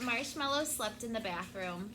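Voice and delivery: ordinary delivery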